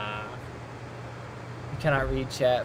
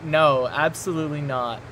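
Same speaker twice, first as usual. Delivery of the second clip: normal talking voice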